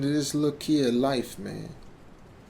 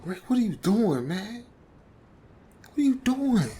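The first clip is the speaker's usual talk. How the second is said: rising higher and higher, alarmed; alarmed pleading; 8 semitones higher than usual. Retold or imitated. Narrator begging Rick as he pops the trunk, rising alarmed plea. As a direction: climb into a cracked, frightened plea.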